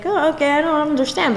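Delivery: put-on Spanish-accented dad, lightly confused; dad's Spanish accent. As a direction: play a baffled Spanish-accented father. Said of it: "and he's like".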